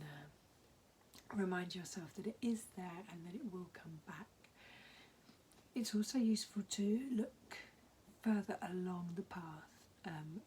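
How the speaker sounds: hoarse, half-whispered voice; talking through a croaky, breathy throat. Real, not acted.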